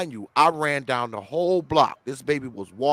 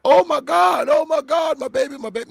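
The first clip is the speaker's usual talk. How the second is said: mock frantic mother; 13 semitones higher, 5 dB louder than usual. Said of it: "It's not even like she's running like".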